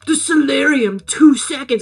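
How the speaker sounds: put-on cryptic caller; terse order from an anonymous caller; lightly hammed-up mystery voice; mysterious voice. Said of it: "it's, like, a mysterious voice".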